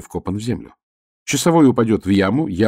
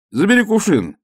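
much higher, louder demand; shout; 12 semitones higher, 4 dB louder than usual. Acted. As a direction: yell it up at him. Shouting to guard from pit.

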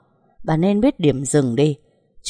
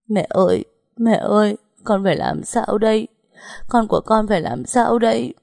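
sobbing plea; 6 semitones higher than usual. Acted. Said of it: "tiếng nấc nghẹn ngào".

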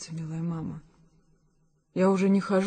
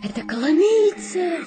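excited; 7 semitones higher, 5 dB louder than usual. Acted. Daughter announcing arrival.

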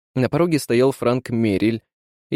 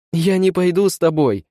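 high, insistent cry of refusal; protesting cry; 7 semitones higher, 3 dB louder than usual. A narrator's reading. Woman refuses to go with supposed husband, crying out high. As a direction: cry out in acted panic. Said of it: "закричала она".

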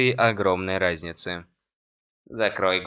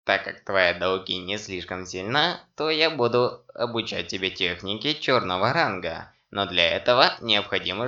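ordinary delivery

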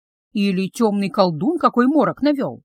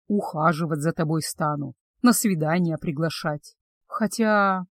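lower, softer male voice; gentle betrothed; 5 semitones lower, 3 dB quieter than usual. Acted. Betrothed promises to court the witch, low and soft.

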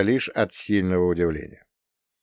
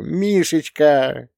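slow, higher cooing; cooing sister; 7 semitones higher, 4 dB louder, much slower than usual. A narrator's reading. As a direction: stretch the name out fondly. Sister cooing his name.

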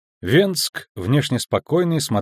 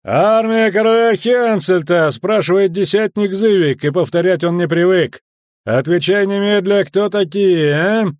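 loud, high, overbearing officer; domineering shouted announcement; barked military demand; 8 semitones higher, 6 dB louder than usual. Acted. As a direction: pull rank on strangers at full voice. Commander announces himself, demands an answer.

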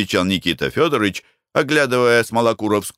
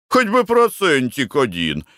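higher-pitched wheedling plea; playing pitiful orphan; 6 semitones higher than usual. A narrator's reading. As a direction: beg as if poor and hard done by. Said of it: "прикинувшись казанским сиротой". (прикинувшись казанским сиротой)

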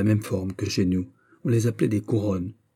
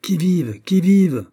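high, loud challenge in the dark; calling out; 8 semitones higher, 5 dB louder, slower than usual. A narrator's reading. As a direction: cry it out like a sentry's challenge. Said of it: "en criant".

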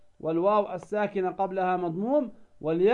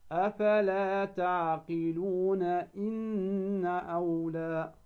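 slow chanted recitation; recited with two-beat madd; 3 dB quieter, much slower than usual. Shown as a demonstration. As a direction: recite slowly, holding the madd two counts. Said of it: "أيضا لو أكملنا".

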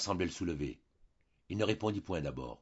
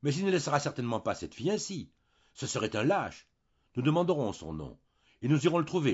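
higher and louder, rising with feeling; moved and animated; 7 semitones higher, 6 dB louder than usual. A narrator's reading. Wife insisting they find the father, agitated. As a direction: stir herself up in indignation. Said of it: "Jeanne, émue, s'animait".

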